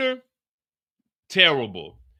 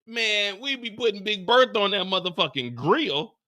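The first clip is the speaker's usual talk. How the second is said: ordinary talk, nothing marked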